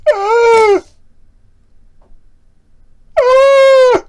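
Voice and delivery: very high, loud scream. Pretend. Streamer screaming at his camera, piercing high shriek. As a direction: shriek at the top of your range. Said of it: "that's scary".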